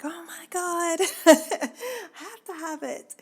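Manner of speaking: high-pitched gush, breaking into a laugh; retold excited gush. Telling listeners about finding that sweater, high excited gush. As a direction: relive the thrill of a lucky find, voice way up.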